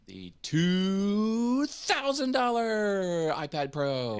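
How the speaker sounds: high, slow, booming prize reveal; corny game show announcer. Pretend. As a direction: sell it like a cheesy TV host. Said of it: "Want me to do the game show announcer?".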